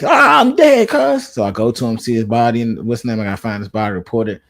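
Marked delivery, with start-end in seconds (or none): 0.0-1.3 high-pitched imitation of a downed teammate; mock dying cry. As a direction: cry out in mock alarm to your squadmate. Voices teammate dying in game.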